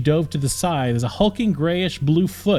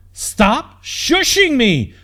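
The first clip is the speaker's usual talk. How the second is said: much higher, louder, very slow scream; top-of-lungs scream; 12 semitones higher, 5 dB louder, much slower than usual. A narrator's reading. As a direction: she screams with all she has. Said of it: "screamed at the top of her lungs".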